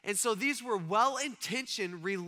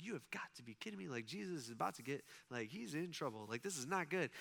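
much softer, fast disbelieving murmur; hushed shock; 2 semitones lower, 9 dB quieter than usual. Retold or imitated. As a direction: gasp it quietly to your neighbour. Shocked crowd reaction, hushed.

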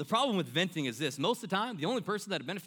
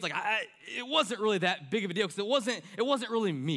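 higher, pleading excuse to a spouse; defensive apology; 7 semitones higher, 4 dB louder than usual. Retold or imitated. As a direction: talk your way out of it, a bit frantic. Apologizing to spouse after anger.

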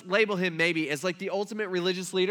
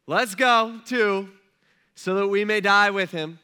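loud, slow, mock-fatalistic rallying; hammed-up pessimist's call; gloomy resolve; 2 semitones higher, 8 dB louder, slower than usual. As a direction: declare a doomed march in a raised voice. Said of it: "negative all the time".

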